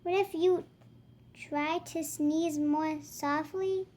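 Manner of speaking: put-on lively read-aloud voice for kids; reading to children. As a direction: act out the book for little ones. Reading picture book dialogue aloud.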